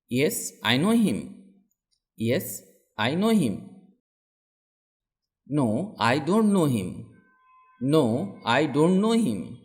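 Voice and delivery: ordinary talk, nothing marked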